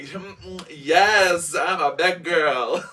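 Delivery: loud, sassy proclamation; sassy shout. Pretend. Mocking own teen self, loud and sassy. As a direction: belt it out with full sass. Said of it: "we're going like".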